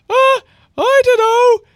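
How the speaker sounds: very high, loud drawn-out squeak; dopey falsetto, stretched out; C C's voice. Acted. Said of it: "I forget what C C's voice is".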